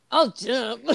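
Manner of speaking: put-on manly voice, trying to sound tough; mock macho bravado. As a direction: imitate a shaky-nerved guy acting all muscular. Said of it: "trying to be all muscular and".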